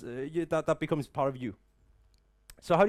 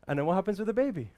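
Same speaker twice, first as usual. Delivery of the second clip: ordinary talk, nothing marked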